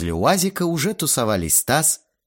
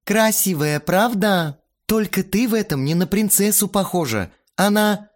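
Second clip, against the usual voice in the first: higher, wry woman in charge; teasing organizer voice; 10 semitones higher, 3 dB louder than usual. A narrator's reading. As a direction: tease then trail off. Irina voiced, trailing off.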